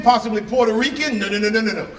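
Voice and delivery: normal talking voice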